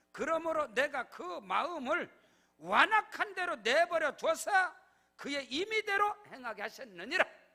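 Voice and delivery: loud, slow scripture decree; thundering scripture. Acted. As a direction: pronounce the judgment heavily. Quoting scripture loudly. (성경 말씀을 무겁게 선포)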